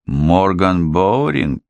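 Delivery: ordinary delivery, nothing marked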